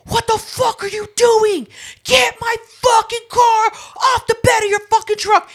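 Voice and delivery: very high, loud raging yell; imitating furious shout. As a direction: scream at the tow driver. Neighbor yelling at tow driver.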